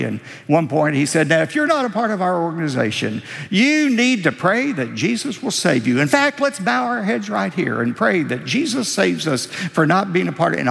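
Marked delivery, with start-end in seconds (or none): 1.3-10.6 fast, high-pitched mock emcee patter; imitated boisterous emcee, pitched up. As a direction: rattle it off like an overbearing program host. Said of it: "was a boisterous man".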